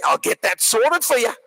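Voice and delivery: busy Aussie waiter; matter-of-fact broad Australian twang; broad Australian accent. Acted. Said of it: "very broad Australian accent".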